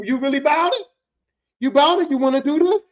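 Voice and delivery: loud, rising, high questions; acted challenge. Coworker turns round to test his rival, challenging. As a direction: square up with each rising question.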